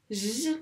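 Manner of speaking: J as a long buzzing "zh"; isolated J sound. Shown as a demonstration. Teacher breaking a syllable into sounds, drawn out. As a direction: hold the consonant J alone. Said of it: "qu'est-ce qu'on entend comme son".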